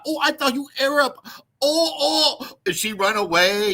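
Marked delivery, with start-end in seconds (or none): 0.0-2.6 high, quick put-on Filipina voice; high mock Filipina accent, startled; imitating Filipina. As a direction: squeak it like a startled woman backing off. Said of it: "and she's like".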